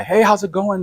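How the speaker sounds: put-on a chatty young salesman greeting customers; talkative salesman. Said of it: "super talkative".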